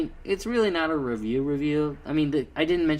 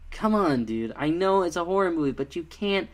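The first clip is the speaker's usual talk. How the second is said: ordinary delivery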